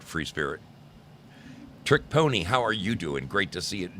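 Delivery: ordinary delivery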